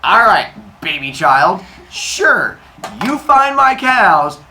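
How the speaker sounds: mock-smug god humoring a child; condescending. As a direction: talk down to a baby you think you can fool. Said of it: "Apollo's like".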